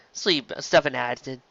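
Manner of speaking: ordinary delivery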